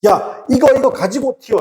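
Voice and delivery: high-pitched acted order to flee; urgent warning call. Teacher showing Korean word order in an emergency, high urgent call. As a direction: call out as if danger is coming.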